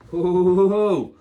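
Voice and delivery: long high, loud "ooh"; loud, high whoop of muscle burn; exertion howl. Real, not acted. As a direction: let out a drawn-out howl after the last rep. Groans after hard final reps.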